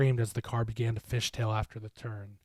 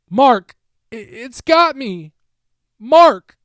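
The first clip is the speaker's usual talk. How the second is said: very high, loud panicked cry; terrified scream; 13 semitones higher, 17 dB louder, much slower than usual. A narrator's reading. A captured voice cries out to Mark, panicked scream. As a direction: scream for help. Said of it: "I heard a voice".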